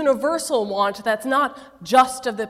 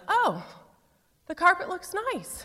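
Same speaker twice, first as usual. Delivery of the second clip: higher, lightly voiced older lady; a hint of a prim church lady; 6 semitones higher, 3 dB quieter than usual. First lady of the church judging the carpet, lightly voiced and higher. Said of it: "She looks around and says".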